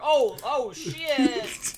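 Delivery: high-pitched dawning dread; hammed-up "uh-oh" fright; mock panic. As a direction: play a cornered rogue's alarm. Rogue sees the owlbear.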